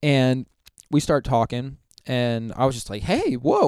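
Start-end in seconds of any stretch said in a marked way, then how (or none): 3.0-3.7 surprised whoa. Retold or imitated. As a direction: pitch jumps way up on a startled whoa. Surprised to meet pastor.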